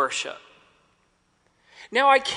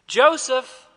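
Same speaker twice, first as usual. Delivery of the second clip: calling out; 5 semitones higher, 5 dB louder than usual. Retold or imitated. Roll call in fog.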